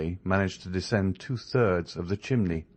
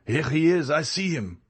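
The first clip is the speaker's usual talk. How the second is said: cry from the landing; 8 semitones higher than usual. A narrator's reading. A pursuer on the landing shouts that he's found Andrea. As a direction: call the others from outside the door. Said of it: "cried a voice from the landing".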